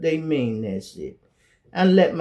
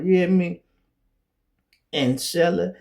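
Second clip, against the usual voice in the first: normal talking voice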